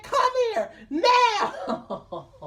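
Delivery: high, drawn-out call laced with a laugh; urgent call. Retold or imitated. Calling his sister over to him, high, slow and laughing. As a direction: beckon her over slowly, half laughing.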